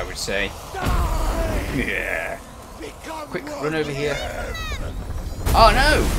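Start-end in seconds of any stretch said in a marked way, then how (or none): 5.5-6.0 loud, high startled oh no; alarmed shout. Real, not acted. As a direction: blurt it out in alarm. Player dodging attacks.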